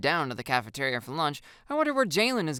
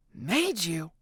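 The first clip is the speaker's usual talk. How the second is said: dry, unimpressed hush; unimpressed whisper. Acted. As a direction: whisper it back, not buying it. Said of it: "I whisper back, unimpressed".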